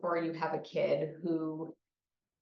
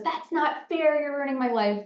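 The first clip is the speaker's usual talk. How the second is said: high, loud whiny protest; hammed-up outraged wail at a parent; furious kid; 9 semitones higher, 5 dB louder than usual. Furious kid protesting a parent's new limit, loud angry whine. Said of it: "Your kid might be furious".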